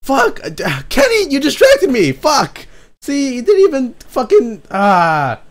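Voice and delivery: high, loud cursing in a fluster; frustrated outburst. Real, not acted. To Kenny, after noticing the wrong music playing: high, loud, flustered cursing. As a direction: shout at the mistake, half laughing.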